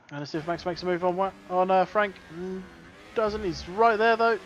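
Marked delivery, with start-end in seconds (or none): none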